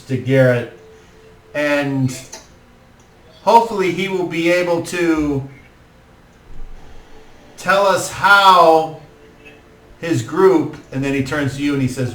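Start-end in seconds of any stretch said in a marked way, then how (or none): 1.5-10.8 high, formal address to the crowd; lord's speech. Acted. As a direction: speak to the hall as host. Lord continues speech.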